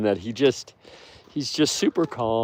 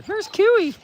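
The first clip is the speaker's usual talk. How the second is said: much higher sing-song greeting; 4 dB louder than usual. Real, not acted. Owner cooing hello to the old lady wolf. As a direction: coo her name up high.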